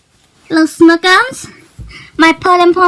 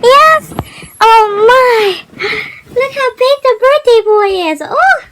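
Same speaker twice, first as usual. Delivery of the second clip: higher, fast, gushing praise; 4 semitones higher than usual. Real, not acted. Excited with birthday boy.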